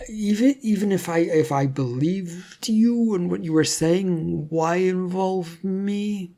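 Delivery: put-on doubtful young man, slowing and faltering; hesitant Leonardo. A narrator's reading.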